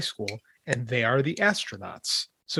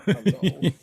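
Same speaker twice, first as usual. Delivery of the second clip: talking to the puppy; 5 semitones higher, slower than usual. Real, not acted. Greeting the puppy arriving.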